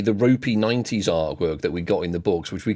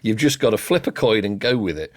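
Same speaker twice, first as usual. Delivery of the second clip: usual speaking voice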